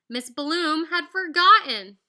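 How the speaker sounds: ordinary delivery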